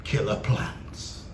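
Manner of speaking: slow, breathy whisper of "Killer plants"; spooky whisper. Acted. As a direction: hush it like a scary secret.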